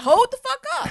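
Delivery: high, mock-outraged halt; retold indignant objection. Retelling her objection to co-host's bass, high mock outrage.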